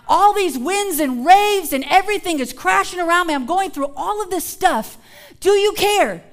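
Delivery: pleading with Jesus in distress; desperate plea. Acted. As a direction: cry out to a silent God. Voicing a sufferer praying.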